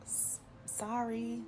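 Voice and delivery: stammered, slow, soft and high; a stuck, slow "S-, sorry"; awkward sorry. Retold or imitated. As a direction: trail into an awkward apology. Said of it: "I'm just like".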